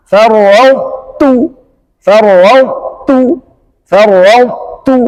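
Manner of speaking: farwautu slow, emphatic t kept; correct farwautu. Shown as a demonstration. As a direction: draw out farwautu. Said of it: "kata yang ketiga".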